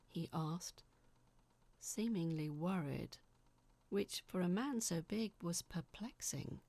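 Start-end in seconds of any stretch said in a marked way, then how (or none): none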